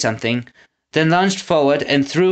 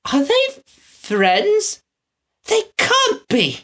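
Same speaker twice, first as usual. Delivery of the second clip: much higher, slow voice of an alarmed townswoman; frightened townswoman; much slower than usual. A narrator's reading. Woman in the crowd fears box trolls, high alarmed cry. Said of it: "called the woman".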